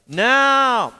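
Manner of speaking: a loud, high call for attention. Real, not acted. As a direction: grab the class's attention. Calling attention mid-lesson.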